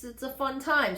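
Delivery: ordinary talk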